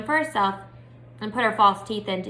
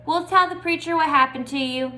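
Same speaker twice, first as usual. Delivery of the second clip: higher, raised shout after her; shouted threat; 6 semitones higher, 4 dB louder than usual. A narrator's reading. Dunlap shouting a tattletale threat after Opal. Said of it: "Dunlap shouted after me".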